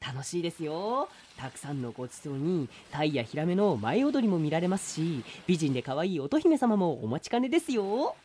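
turtle sales pitch. Acted. Turtle pitches Ryugu castle.

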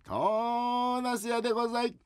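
slow, high, drawn-out peddler call; big vendor cry. Acted. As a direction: stretch the cry out slowly. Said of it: "大きな声で言えっつったな". (ゆっくり伸ばした売り声)

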